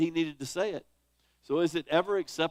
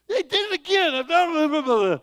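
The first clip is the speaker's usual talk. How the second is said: much higher and louder, fed-up complaining; exasperated venting; 12 semitones higher, 11 dB louder than usual. Retold or imitated. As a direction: blow off steam about a coworker. Husband venting to wife about coworker.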